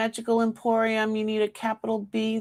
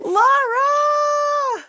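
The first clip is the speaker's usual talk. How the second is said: high, loud shout of the name; excited shout; 6 dB louder than usual. Real, not acted. Host announcing the giveaway winner with a high excited shout. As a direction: call out the winner with glee.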